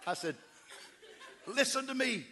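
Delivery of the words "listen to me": higher, louder call to a panicking man; shouting to be heard; yelling. Retold or imitated. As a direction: raise the voice to get through to him. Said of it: "I had to scream it about seven times".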